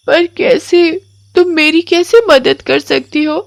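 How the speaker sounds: put-on high child voice, gentle and wondering; curious little girl. Komal gently asks the crying witch, high child voice.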